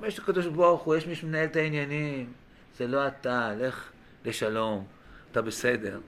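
low, soft, unhurried absolution; gentle letting go. Acted. Forgiving person telling the offender it was God's doing, gently. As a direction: let them off quietly. Acted forgiveness of one who wronged.

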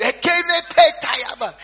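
loud, high mock tongues babble; mock tongues shouting. Uncle bursts in babbling loud in tongues. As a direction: ham up a shouting prophet.

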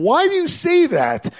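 high, loud, drawn-out question; incredulous challenge. Retold or imitated. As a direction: demand an explanation in disbelief. Acting out a challenge to WebMD.

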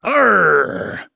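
gruff pirate captain calling across the room; hearty sea captain hailing a stranger. Acted.

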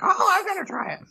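put-on eager kid voice. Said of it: "you're like".

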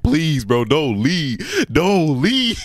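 mock desperate begging, rising at the end; retold screamed plea. Boyfriend begging her not to leave, mild mock screamed plea. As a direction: imitate begging, no real scream. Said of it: "I'm in the room screaming like".

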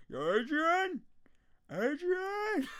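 high, loud Rocky bellow with a laugh; laughing Rocky impression shouting for his wife; Rocky calling Adrian. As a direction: yell for Adrian like Rocky after the fight. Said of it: "Rocky was so lucky to find Adrian".

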